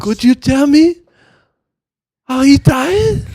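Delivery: put-on high, loud, slow Japanese-accented question; exaggerated Japanese film-character accent. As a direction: ask solemnly in a hammed-up Japanese accent.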